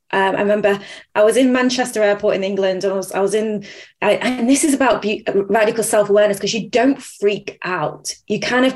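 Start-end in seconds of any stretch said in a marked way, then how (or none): none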